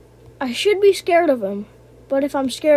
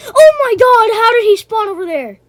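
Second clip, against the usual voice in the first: real fright, much higher and louder with a cry; startled scream; 9 semitones higher, 11 dB louder than usual. Real, not acted. Gamer jump-scared by a monster spawning close, terrified scream. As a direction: shriek as the monster pops up without warning.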